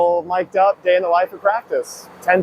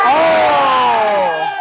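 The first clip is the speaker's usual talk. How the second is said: long, high "Oh!" falling away; real whoop at a big play; excited shout; 5 semitones higher, 4 dB louder than usual. Real, not acted. Hockey player reacting during practice, excited shout.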